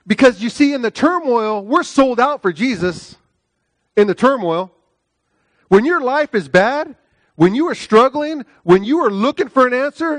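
high, loud impassioned preaching. Acted. Pastor urging the congregation, impassioned preaching. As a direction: drive it home like a fired-up preacher. Preaching builds to full force.

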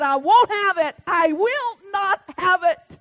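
loud, high, slow voice of God; booming divine refusal, slowing at the end; God declaring. Acted. God's voice in the preacher's story.

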